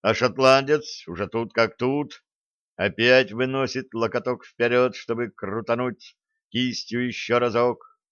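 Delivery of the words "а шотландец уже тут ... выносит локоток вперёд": ordinary delivery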